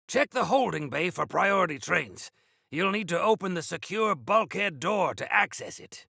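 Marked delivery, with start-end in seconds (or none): none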